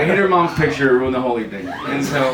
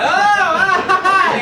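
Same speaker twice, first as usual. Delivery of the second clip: high-pitched comic falling scream; 4 dB louder, slower than usual. Acted. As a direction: wail as if plummeting, hammed up. Acts out the falling jumper.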